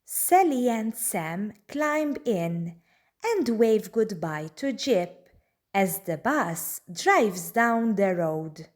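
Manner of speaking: ordinary delivery, nothing marked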